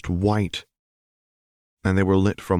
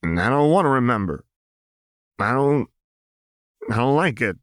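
put-on high strained young voice, refusing to remember; pushing the memory away, upset; Jinx, distressed; 6 semitones higher than usual. A narrator's reading.

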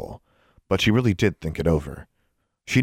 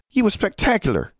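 very high-pitched gushing voice; gushing praise. A narrator's reading. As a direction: gush admiringly, way up high. Friend gushes over the duel.

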